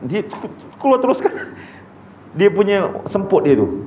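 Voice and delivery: ordinary delivery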